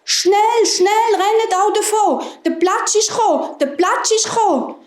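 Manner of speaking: high, urgent alarm call; panicked calling. A narrator's reading. Hares and hedgehogs warn the mice, high panicked calling. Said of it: "haben gerufen".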